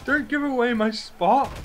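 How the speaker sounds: laughing protest. Real, not acted.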